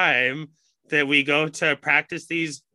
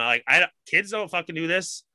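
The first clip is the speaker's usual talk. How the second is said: normal talking voice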